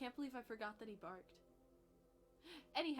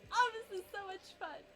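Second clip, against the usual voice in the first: high, loud, gleeful dog voice; pitched-up carefree pet impression; voicing the dog; 10 semitones higher, 7 dB louder than usual. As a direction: play a dog having a great time. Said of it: "He's like".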